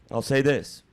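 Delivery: normal talking voice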